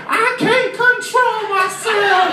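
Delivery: put-on very high, drawn-out kid scream; hyper little boy. Sugared-up boy mid-floor to everyone, frantic high wail. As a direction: wail it slowly like a sugar-crazed child. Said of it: "stopped in the middle of the floor and said".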